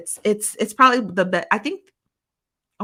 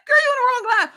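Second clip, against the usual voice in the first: high, laugh-crying repeat; 7 dB louder, faster than usual. Real, not acted. Vlogger repeats the retort to a viewer, laughing. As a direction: still cracking up.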